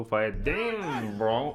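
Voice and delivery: drawn-out mock American slang, pitch swooping up; imitating a streamer's English. Mocking a creator who copies Speed's English, drawn-out imitation. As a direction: stretch it out mimicking a hyped streamer. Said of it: "عم يحكي انجليزي صار مثله".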